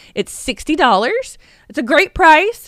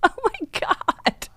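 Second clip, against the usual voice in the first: slow, high, softened exclamation; stunned; 8 semitones higher, 5 dB quieter, much slower than usual. Real, not acted. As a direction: breathe it out slowly in disbelief. Still stunned by the news.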